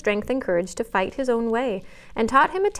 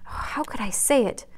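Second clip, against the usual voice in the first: tearful, fading; 3 semitones higher than usual. A narrator's reading. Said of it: "crying with repentant tears".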